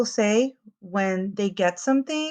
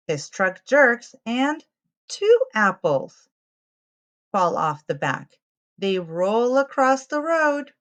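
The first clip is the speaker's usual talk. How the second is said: lively picture-book read-aloud; reading to children. A narrator's reading. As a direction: tell it to little ones, clearly and brightly.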